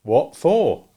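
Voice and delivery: blunt question from a slow thinker; a hint of Joe's bluff puzzlement; puzzled Joe. A narrator's reading. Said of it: "slowly considering".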